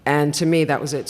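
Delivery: usual speaking voice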